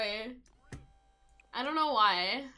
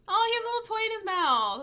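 cooing at the dog; 10 semitones higher, 4 dB louder than usual. Real, not acted.

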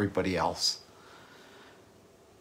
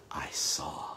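awed whisper; 9 semitones lower, 12 dB quieter than usual. Acted. Preacher recalling the sanctuary, hushed awed whisper. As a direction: breathe it out in a hush.

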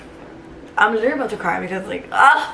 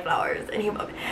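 whisper; 2 semitones lower, 11 dB quieter than usual. Real, not acted. Overwhelmed teacher telling touching gift.

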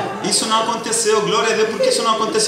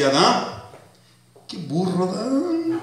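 mocking Eve. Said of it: "A mulher fala assim".

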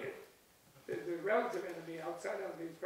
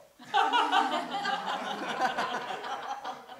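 long, loud, high laugh; hearty laugh; 6 semitones higher, 7 dB louder than usual. Real, not acted. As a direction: burst out laughing at your own story. Laughs at own retold retort.

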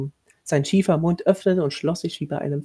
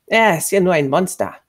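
put-on loud, angry boy's retort; cursing; 3 semitones higher, 6 dB louder than usual. A narrator's reading. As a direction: spit it out like a curse. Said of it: "fluchte der schmächtige Junge". (wütend geflucht)

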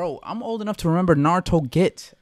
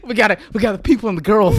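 laughing; 3 semitones higher, 5 dB louder, faster than usual. Real, not acted. Laughing hard.

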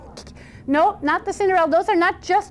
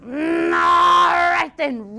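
grudging, long-drawn troll bellow; high, slow roar of assent; troll's roar; 4 semitones higher, much slower than usual. Acted. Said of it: "roared the troll".

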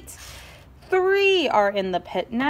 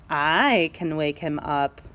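lower, slow, sneaky; plotting mischief; slow scheming voice; sly whisper-ish plan; 8 semitones lower, slower than usual. Acted. Sly Chinchetta plans.

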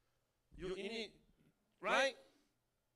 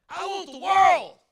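mock demand; 6 semitones higher, 11 dB louder, slower than usual. Congregant voiced demanding the world.

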